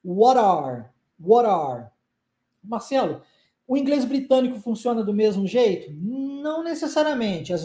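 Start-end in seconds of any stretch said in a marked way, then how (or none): 0.0-1.9 what are blended into one word with an R sound; American linked what are. Shown as a demonstration. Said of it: "junção de duas palavras que na pronúncia se tornam uma".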